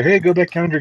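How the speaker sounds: usual speaking voice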